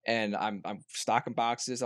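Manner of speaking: ordinary delivery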